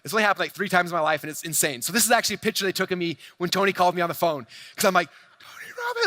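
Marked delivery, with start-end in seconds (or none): 5.4-6.1 squealed far higher, starstruck; fanboy shriek of a famous name; freaking out. Retold or imitated. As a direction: gasp out the name like a starstruck fan. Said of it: "like, freaking out".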